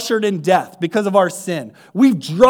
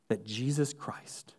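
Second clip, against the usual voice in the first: breathy whispered name; 9 semitones lower, 18 dB quieter, slower than usual. Acted. Preacher whispering the name to the congregation, hushed.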